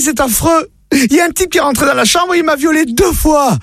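wife's voice pushed up high, breathless with shock; high panicked wife. Acted. Wife tells husband of intruder, high shocked voice. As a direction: squeal it out like a horrified wife.

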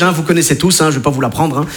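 ordinary talk, nothing marked

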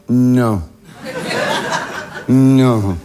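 low-pitched, drawn-out no; dreading refusal. Retold or imitated. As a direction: drop low in disbelief. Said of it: "je fais". (non grave et incrédule)

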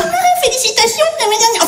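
high, sing-song mocking voice; nagging sing-song mimicry; mock congratulations. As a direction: chirp fake congratulations.

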